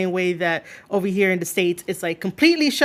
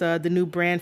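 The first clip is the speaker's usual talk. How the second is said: ordinary talk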